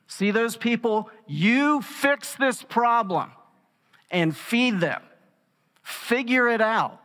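Jesus telling the disciples to fix it, forcefully; forceful command. Retold or imitated. Jesus pushes disciples to feed crowd, a forceful command. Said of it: "Very forceful".

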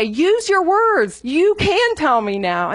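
slow, high coaxing mom voice; patient parent. Retold or imitated. Mother tells whining toddler to use words, slow and coaxing. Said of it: "and you say".

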